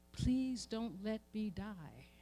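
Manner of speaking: hushed, drawn-out plea for life; a hushed lament under the pain; quiet slow plea. Acted. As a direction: soften and stretch each word. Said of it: "the cry was".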